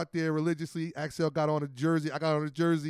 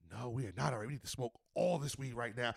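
lower, softer stressed reply; lower, softer weary groan; 6 semitones lower, 8 dB quieter than usual. Retold or imitated. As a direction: sound worn out and stressed. Stressed reply, low and soft.

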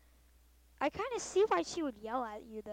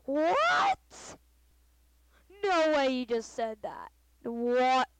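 shocked teacher; 12 dB louder, much slower than usual. Retold or imitated. A teacher hearing a kid threaten her, mock shock. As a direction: gasp it out in disbelief. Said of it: "she'd be like".

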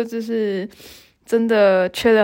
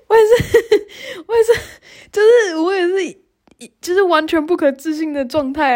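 laughing in disbelief; 8 semitones higher than usual. Real, not acted. Recalling she could not believe it, laughing. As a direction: laugh helplessly through the words.

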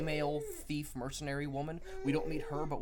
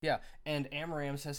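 ordinary talk, nothing marked

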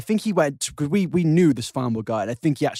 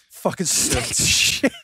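slow gruff quote; much slower than usual. Pretend. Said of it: "they go".

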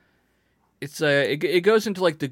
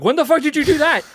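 high, louder shocked exclamation; shocked outburst; 14 semitones higher, 8 dB louder than usual. Retold or imitated. Worker hears the old woman cut her arm, high shocked outburst. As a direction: burst out in disbelief. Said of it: "I'm like".

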